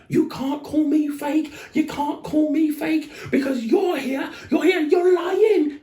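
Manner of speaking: mock rant in a very high, drawn-out voice; hammed-up high protest; high-pitched going off. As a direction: imitate an outraged man squealing his accusations. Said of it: "I don't know why he got so high end".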